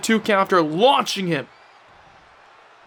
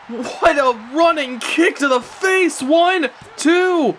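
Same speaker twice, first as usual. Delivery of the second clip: raised, drawn-out excited call; excited play-by-play; 4 semitones higher, slower than usual. Real, not acted. Commentator counts the pinfall after a running kick, excited.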